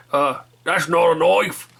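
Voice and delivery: slow, casual Australian drawl; a lightly done Aussie knife-line impression; Australian accent. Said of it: "I can kinda do that Australian accent".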